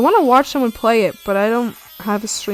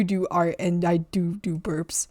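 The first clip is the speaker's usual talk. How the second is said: whisper; 5 semitones lower, 8 dB quieter than usual. Real, not acted. Confessing in a whisper.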